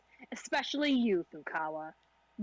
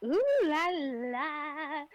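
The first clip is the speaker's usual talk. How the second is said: drawn-out sing-song ooh; playful ooh la la; 5 semitones higher, 4 dB louder, much slower than usual. Real, not acted. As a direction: stretch it out teasingly. Playful exclaim before chapter.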